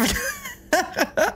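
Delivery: squeaky laughing cry; high squealing laugh. Real, not acted. End of run, laughs.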